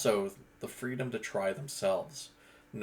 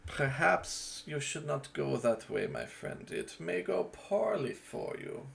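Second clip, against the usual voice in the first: slow, drawn-out warning to a friend; a slow, measured character warning; first character take; 7 semitones higher, slower than usual. Shown as a demonstration. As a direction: deliver the warning slowly, with weight. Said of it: "As opposed to".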